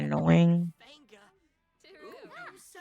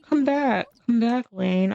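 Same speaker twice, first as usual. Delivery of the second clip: calling back; 6 semitones higher, slower than usual. Real, not acted. Calling Sim back in game.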